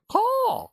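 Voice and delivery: very high crow squawk; crow caw. Acted. Streamer explaining the crow pun, cawing. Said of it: "'cause they're crows".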